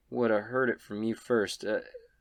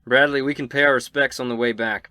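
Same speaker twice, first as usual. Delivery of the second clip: raised voice, projected to a companion; calling out; 3 semitones higher, 8 dB louder than usual. Acted. Inverna to Bradley on horseback, calling out. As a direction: call it out a bit louder. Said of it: "Verna calls out to you".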